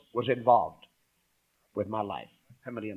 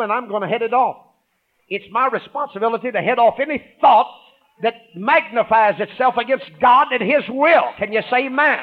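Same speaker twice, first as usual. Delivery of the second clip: forceful, raised preaching voice; 7 semitones higher, 6 dB louder than usual. Acted. Pastor heading off doubt, preaching loud. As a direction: drive the point home to the congregation.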